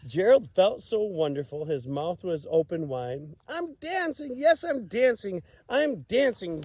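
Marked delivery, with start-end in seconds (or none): 3.5-6.6 high, bright thrilled shouts of joy; joyful cry. Acted. Gerald discovers he can dance, joyful high cry. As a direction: burst out with delight. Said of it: "Gerald cried".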